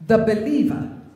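"believer" punched out loud; emphatic, forceful "believer"; stress on believer. Real, not acted. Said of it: "Emphasis on believer".

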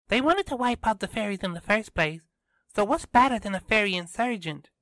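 put-on high, brisk girl character voice; Kiara arguing, insistent. A narrator's reading. Kiara disputes the robot's ending with Tristan, high insistent voice.

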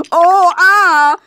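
hammed-up high moan; overdone moaning cry; mock moan. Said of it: "I moaned".

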